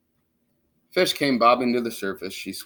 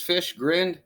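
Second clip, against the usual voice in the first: ordinary delivery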